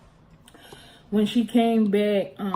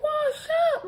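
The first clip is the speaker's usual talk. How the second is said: very high, crying-out disbelief; shrill shocked question. Retold or imitated. Child horrified at being told to wash up, crying out high. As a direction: shriek it in horror.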